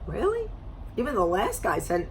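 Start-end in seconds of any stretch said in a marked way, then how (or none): 0.1-0.5 incredulous. Real, not acted. Incredulous aside.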